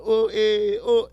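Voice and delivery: high, loud "Oh, eh" greeting grunt; comedian greeting grunt. Retold or imitated. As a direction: toss out a knowing "Oh, eh". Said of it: "that's how stand-up comedians talk to each other".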